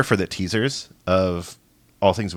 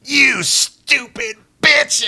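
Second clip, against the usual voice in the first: much higher, slow, louder doll snarl; snarling Chucky; 5 dB louder, much slower than usual. Acted. Chucky turning on the woman: slow high snarl. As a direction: spit the insult out slowly like the killer doll. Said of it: "Chucky's actual face and he's like".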